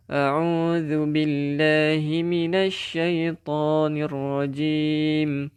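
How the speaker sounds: melodic Arabic chanting of Al-Fatihah; تلاوة مجودة; Quran recitation. Acted.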